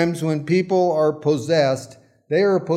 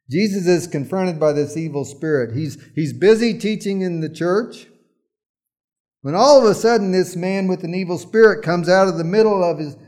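ordinary delivery

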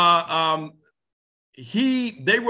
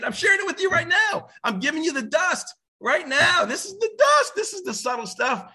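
emphatic shout; 13 semitones higher than usual. Real, not acted. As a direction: hammer the point home to the crowd. Preacher hammering his point home.

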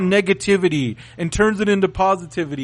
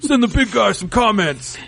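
high wrestler promo yell, laughing; Ryback voice; 6 semitones higher than usual. Retold or imitated. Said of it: "I was trying to do a Ryback voice".

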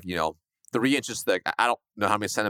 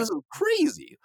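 retold panic. Scene: salesman retelling zero appointments to host, panicked high voice. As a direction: pitch shooting up in mock panic. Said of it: "I was a little freaking".

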